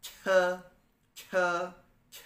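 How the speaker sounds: "C" said as a forceful, puffed "che"; stressed CH. Shown as a demonstration. Teacher showing CH with added pressure, stressed chuh. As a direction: press on each "C", a breath-filled chuh. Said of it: "nada tekanannya gitu".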